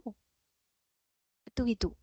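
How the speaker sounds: slow hushed rhetorical question; soft slow repeat. Shown as a demonstration. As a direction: let the question sink in quietly.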